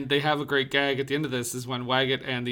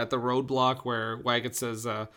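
usual speaking voice

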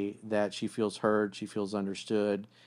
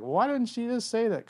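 much higher, baffled guy; mock baffled guy; 12 semitones higher than usual. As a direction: squeak out his confusion.

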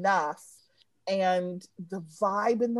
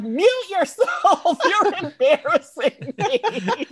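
slow, higher, louder mock telling-off; mock boss scold; 9 semitones higher, 6 dB louder, slower than usual. Friend mimics boss telling her to mute, slow mock scold. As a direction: drag it out like an embarrassed boss. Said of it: "Michael's like".

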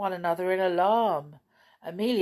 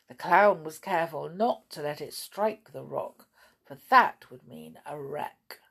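plain reading voice

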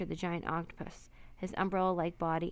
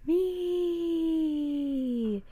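wild, shrill cry; 9 semitones higher, 5 dB louder than usual. A narrator's reading. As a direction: stretch it into one eerie high note. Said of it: "The sound was wild and shrill".

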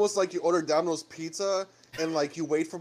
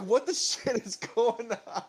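laughing rant; 5 semitones higher, slower than usual. Retold or imitated. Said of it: "I'm like".

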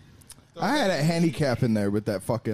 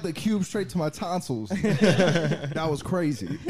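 laughing; 9 semitones higher, slower than usual. Real, not acted. Laughing after ice hit throat.

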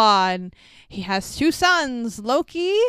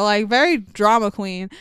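ordinary delivery

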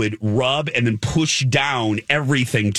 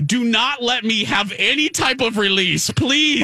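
panicked plea; 10 semitones higher than usual. Retold or imitated. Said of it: "So now I am sitting there going".

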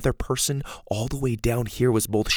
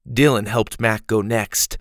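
usual speaking voice

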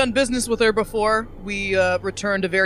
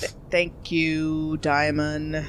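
slow, low, halting thanks; awkward thanks; 7 semitones lower, 5 dB quieter, much slower than usual. Acted. Player character thanking Diamond after the outburst, awkward. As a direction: draw it out low and soft, hesitant.

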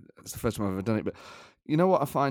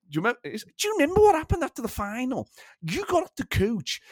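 put-on higher, German-accented pundit quote; comic put-on accent; Didi Hamann; 8 semitones higher than usual. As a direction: imitate a pundit reminiscing on a podcast. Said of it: "It's so funny the way he talks".

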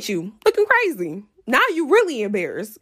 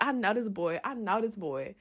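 hushed stunned murmur, much softer; shook murmur; 3 semitones lower, 11 dB quieter than usual. Retold or imitated. Seeing her man on a date, a stunned soft murmur. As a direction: mutter it to yourself in disbelief.

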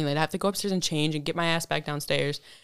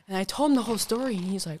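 ordinary delivery

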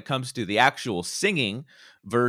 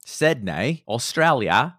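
mock Australian with rising endings; Australian accent; 3 dB louder, slower than usual. American host echoing singer's hometown, Australian accent. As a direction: echo the place in a put-on Aussie accent. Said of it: "when Americans do Australian accents".

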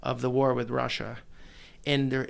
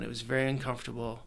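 ordinary talk

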